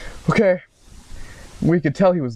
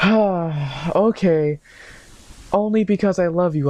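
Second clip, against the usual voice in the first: higher, reluctant lover's voice; mock girlfriend giving in; 6 semitones higher, slower than usual. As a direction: give in with a high voice.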